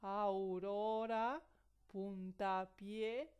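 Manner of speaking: very slow, careful "aurora" and "puntapié"; example words. Shown as a demonstration. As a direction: draw out "aurora" and "puntapié" for the class. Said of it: "que pueden ser vocales".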